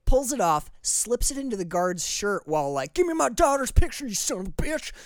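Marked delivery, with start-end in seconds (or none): 2.9-4.1 Alabama swearing voice. Retold or imitated. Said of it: "This is my Alabama swearing voice". 4.1-4.9 put-on Alabama accent. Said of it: "This is my Alabama swearing voice".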